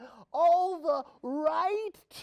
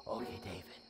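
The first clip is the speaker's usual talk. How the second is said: much lower and softer, hushed; sneaky whisper; 6 semitones lower, 8 dB quieter, faster than usual. Acted. Soldier urging David beside sleeping Saul, hushed whisper. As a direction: whisper so the king won't wake.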